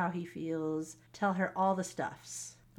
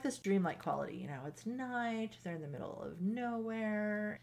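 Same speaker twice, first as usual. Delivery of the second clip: usual speaking voice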